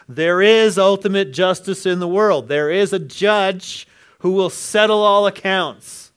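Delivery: loud, high preacher's proclamation; full-force preaching. Acted. Preacher proclaiming God's justice to the congregation. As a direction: proclaim it to the back row.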